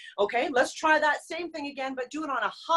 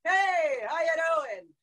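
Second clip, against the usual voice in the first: calling out; 4 semitones higher, slower than usual. Shown as a demonstration. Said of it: "Try that".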